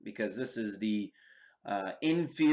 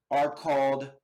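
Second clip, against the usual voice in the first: normal talking voice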